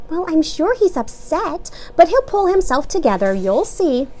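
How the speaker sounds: high, calm reassurance; reassuring mother. A narrator's reading. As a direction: comfort the child.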